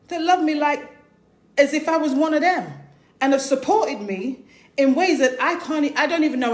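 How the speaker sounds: real feeling pushing the words up and out; getting emotional. Real, not acted. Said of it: "See, I'm getting emotional now".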